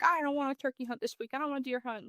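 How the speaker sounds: lightly voiced sulky kid; kid complaining. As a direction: imitate a son griping to family. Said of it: "just complaining to them".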